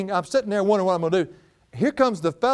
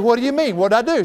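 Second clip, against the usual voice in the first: higher, louder shocked question; startled protest; 8 semitones higher, 6 dB louder, faster than usual. Retold or imitated. Husband asking his wife what he did, startled and louder. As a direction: jump up in surprise.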